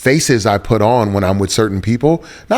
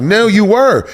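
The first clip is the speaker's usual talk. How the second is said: high-pitched retold comeback; playful insistence; 12 semitones higher, 3 dB louder, slower than usual. Son contradicts dad about his voice, high playful insistence.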